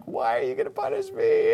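very high, soft mock kid whine; put-on squeaky child's complaint; self-pitying toddler. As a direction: whimper like a caught toddler. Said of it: "just feeling sorry for themselves".

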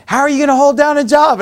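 lightly voiced exasperated mother; imitating wife. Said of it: "she said to, like, a nine-year-old".

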